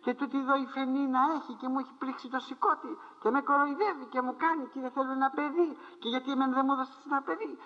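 high, pleading woman's voice to God; Hannah's lament. Retold or imitated. Hannah begs the Lord for a child, high and pleading. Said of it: "του μιλούσε του Κυρίου".

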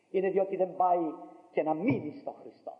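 ordinary delivery

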